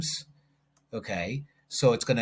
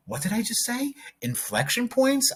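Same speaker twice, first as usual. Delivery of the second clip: much higher, disbelieving question; startled surprise; 11 semitones higher than usual. Real, not acted. Teacher realizing what she just told students, high startled surprise. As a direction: stop short, amazed at your own words. Reacts to saying the term.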